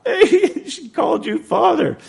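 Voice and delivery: high-pitched teasing through laughter; laughing priests. Retold or imitated. Priests ribbing Gary over being called Father, laughing imitation. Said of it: "all the priests that were there just laughed".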